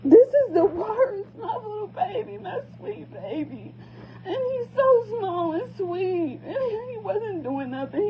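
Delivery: sobbing. Real, not acted. Mother breaking down over son.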